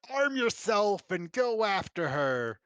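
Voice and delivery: loud, slow commanding yell. Acted. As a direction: yell orders to the crowd. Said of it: "Mayor Anathram yells".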